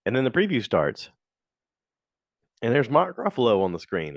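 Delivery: ordinary talk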